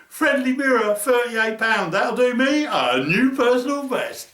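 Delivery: a mildly giddy, pleased reply. Retold or imitated. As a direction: sound delighted with the catch. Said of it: "I went".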